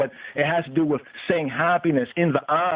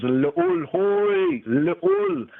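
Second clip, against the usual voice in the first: high intoned; 13 semitones higher, much slower than usual. Shown as a demonstration. Teacher chanting the Amharic name of the Most High, high and sung. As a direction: sing it out much higher, held.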